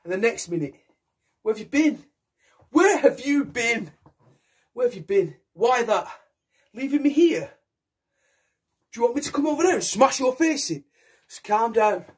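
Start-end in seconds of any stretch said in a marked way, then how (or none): 1.4-10.8 put-on high, rising drunken fury, repeating the question; drunken rage. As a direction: turn on your friends, spoiling for a fight. Drunk James turning on friends.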